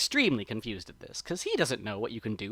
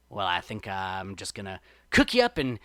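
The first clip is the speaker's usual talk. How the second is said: mild menace building to a louder finish; snarling threat; 3 semitones lower, 6 dB louder than usual. A narrator's reading. Brer Fox threatens his captive Brer Rabbit, mild snarling menace. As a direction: threaten them, loud on the last words. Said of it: "and he snarls".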